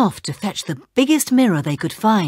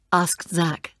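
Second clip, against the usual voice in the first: usual speaking voice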